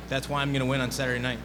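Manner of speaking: ordinary talk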